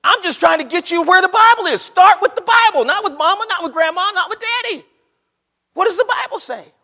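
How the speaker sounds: high, shouted pulpit exhortation; very loud, high, hammering sermon voice; full-force preaching. Real, not acted. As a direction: shout it insistently at the congregation.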